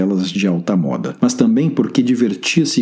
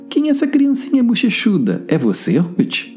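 curious friend voice; 12 semitones higher than usual. Acted. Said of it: "Mara estava curiosa".

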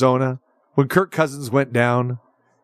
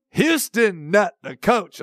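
slow, lightly Southern drawled Houston Nutt; a light Southern twang on the name; 5 semitones higher, slower than usual. Pretend. Host slips into a Southern twang announcing the guest. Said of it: "my Southern twang".

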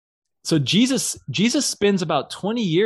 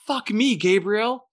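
high-pitched mock dismay; exasperated exclamation; 8 semitones higher, 3 dB louder than usual. God reacting to Gabriel's confession, high exasperated exclamation. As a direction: blurt it high, in disbelief.

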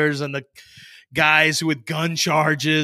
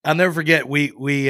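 ordinary delivery

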